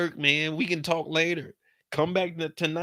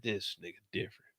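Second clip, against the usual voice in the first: muttered aside; 7 semitones lower, 13 dB quieter than usual. Retold or imitated. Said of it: "I said".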